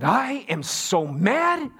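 high-pitched, drawn-out mock outrage; hammed-up indignation, pitch leaping; worked-up angry aunt. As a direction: imitate a furious aunt, stretching each word. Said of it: "you just knew her blood pressure".

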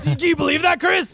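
high, loud gloating whoops; excited shout. Real, not acted. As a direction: crow with glee at the prank. Gloating after the prank.

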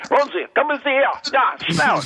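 high, overdone dubbed TV voice; german dubbing voice. Retold or imitated. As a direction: imitate a cheesy German TV dub. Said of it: "it was all dubbed in German".

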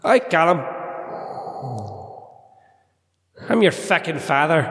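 put-on slight Irish accent on a Sith Lord; Darth Parks. Irish Sith Lord greeting his son Callum, slight Irish accent. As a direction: play the villain's reveal in a light Irish accent. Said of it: "do a slight Irish accent when you do this".

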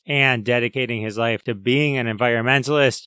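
ordinary delivery

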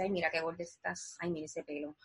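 low, soft self-critical aside; inner critic voice. Acted. Inner voice criticizing her looks, low inner critic. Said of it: "otra voz que puede parecerse a esta voz que te dice".

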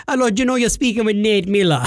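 imitated Jamaican phone caller; scammer's greeting in a Jamaican lilt; Jamaican accent. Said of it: "He was from Jamaica".

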